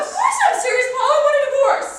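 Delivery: very high, loud demand; full-voiced hysterical declaration; furious shout. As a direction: scream it as a final word. Wife yells demand for divorce.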